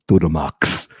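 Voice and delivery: low, sassy drawl; sassy reply. Retold or imitated. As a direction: toss it off with sass. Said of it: "he responded".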